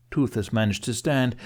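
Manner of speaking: ordinary delivery, nothing marked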